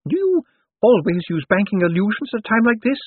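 put-on high-pitched old lady voice; Topsy Lavish. A narrator's reading. Dead woman excuses herself to Death, high and pert.

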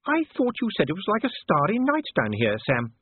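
high-pitched, impressed lady's exclamation; gasping Sybil. A narrator's reading. Wife remarks to her husband on the dazzling candles, gasping. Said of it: "he heard Sybil gasp".

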